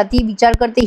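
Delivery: normal talking voice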